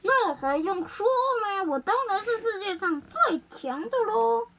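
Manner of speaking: high, boastful sun voice; smug, triumphant. A narrator's reading. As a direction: gloat as if it's obvious. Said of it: "太阳得意洋洋地说".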